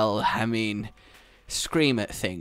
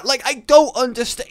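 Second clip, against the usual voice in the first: louder, higher, fed up; exasperated shout; 10 semitones higher, 7 dB louder than usual. Real, not acted. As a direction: throw up your hands in disbelief. Exasperated after imitating scream.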